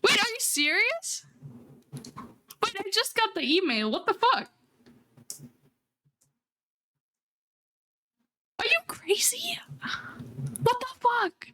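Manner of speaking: high, stunned disbelief at a gift; overwhelmed streamer reacting to an unexpected present; real surprise. Real, not acted. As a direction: squeal out wait, are you serious, caught off guard. Viewer sends a surprise gift.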